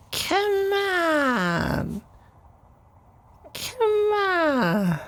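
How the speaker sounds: very high coaxing "come on"; squeaky high call. Acted. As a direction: call them in a tiny squeaky voice. Coaxing listener to go to bed.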